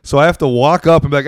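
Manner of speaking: ordinary talk, nothing marked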